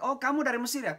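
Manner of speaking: high, loud mock call from the crowd; retold student shout. A student calling out to the lecturer, high mock shout. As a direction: imitate a confident wrong guess. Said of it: "Ada yang teriak".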